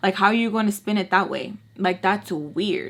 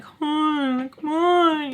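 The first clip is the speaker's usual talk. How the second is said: put-on higher voice of a kid begging to go; whiny kid brother; 7 semitones higher, much slower than usual. Little brother pestering his sister to leave, whiny and higher. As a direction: nag like an impatient little boy.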